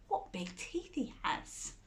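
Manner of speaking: a sly unvoiced aside; whispered aside. Storyteller confiding to children, whispered. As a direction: whisper it to the kids.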